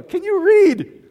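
high, loud, slow sarcastic question; sarcastic jab. Retold or imitated. Pastor retorts to a screaming churchgoer, loud sarcasm. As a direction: drag it out, incredulous and loud.

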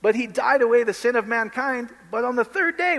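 ordinary talk, nothing marked

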